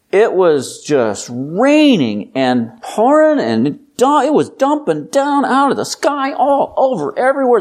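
rising high and worked up, piling on words; emphatic exaggeration. Shown as a demonstration. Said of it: "what if I use a bunch more words".

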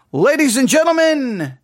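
big showman announcement; ringmaster. Pretend. Mock announcer opening the review.

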